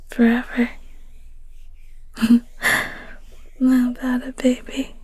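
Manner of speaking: gentle caring whisper to a sick partner; tender bedside whisper, unhurried. Acted. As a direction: whisper close and soothing. Caring ASMR whisper roleplay.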